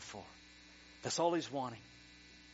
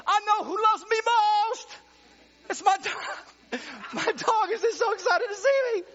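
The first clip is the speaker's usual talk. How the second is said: laughing; 11 dB louder than usual. Real, not acted. Laughing about his dog.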